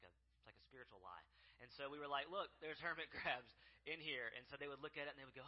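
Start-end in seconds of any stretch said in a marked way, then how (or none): 2.3-4.3 laughing tease. Retold or imitated. Showing kids the bucket.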